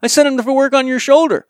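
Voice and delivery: high, angry mock rant from a doctor; angry doctor chewing out. Doctor on the phone chewing him out, high angry rant. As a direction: mimic a furious doctor yelling down the phone. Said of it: "chew me out and go".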